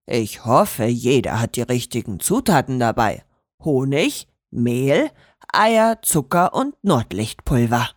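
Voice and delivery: low, steady teacher voice listing ingredients; lightly voiced master baker. A narrator's reading. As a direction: read out the list like a kindly old master.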